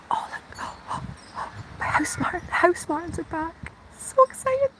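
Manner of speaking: breathless whisper rising into a high squeal; hushed thrill at the birds, building to a squeak; excited whisper. Real, not acted. Spotting the house martins returning, whispering then squealing with joy. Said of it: "I don't know why I'm whispering".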